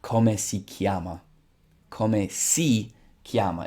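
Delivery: Italian pronunciation demo; slow Italian demo. Shown as a demonstration. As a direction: Come si chiama said slowly, falling at the end. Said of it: "in Italian, they say".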